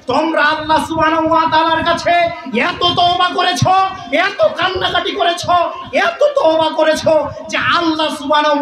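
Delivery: high, sustained chanting cry; shouted proclamation. Acted. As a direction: call out good news as if from afar. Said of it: "একজন সাহাবী চিৎকার করে বলছে".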